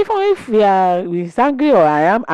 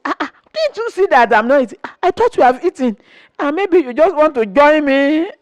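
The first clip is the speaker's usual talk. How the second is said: quick, rushed mock protest; fast retold protest; 5 semitones higher than usual. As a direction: rattle it off, teasing him. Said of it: "So when I say".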